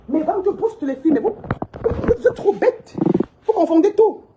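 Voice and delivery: high, slow jeering at critics; mocking taunt. Real, not acted. A woman mocks her online critics, high and slow. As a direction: tease them, drawn out and high.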